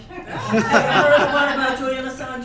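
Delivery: laughing, loud cheerful retort to the eye-rollers; bright loud comeback. Shown as a demonstration. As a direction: boom it out like a punchline setup. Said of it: "Please respond by saying".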